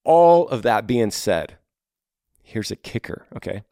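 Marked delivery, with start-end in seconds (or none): none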